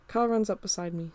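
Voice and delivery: usual speaking voice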